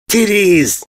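high, frustrated yell; frustrated scream. A narrator's reading. Frustrated invader screaming at the ponies, yelling. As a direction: shout it out in frustration. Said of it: "the frustrated invader screamed".

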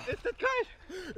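slow, high, gasping from the cold; in ice cold water. Real, not acted. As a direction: gasp the words in the cold. Stepping into cold lake. (im eiskalten Wasser, keuchend)

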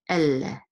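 "elle" with a long doubled L; "elle" pronounced el-leh, L held. Shown as a demonstration. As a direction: linger on the L in "elle". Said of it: "hold the lamed sound a little bit".

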